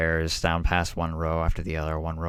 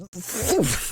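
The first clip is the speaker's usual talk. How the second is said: high mouth-made whoosh; breathy throw noise; whoosh sound effect; 13 semitones higher than usual. Acted. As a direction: imitate a body flung through the air. Imitates man being thrown through air.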